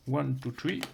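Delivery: English words in a joking English accent; accent anglais merveilleux. Shown as a demonstration. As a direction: count playfully with a put-on English accent. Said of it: "avec mon, mon accent anglais, euh, merveilleux". (accent anglais pour rire)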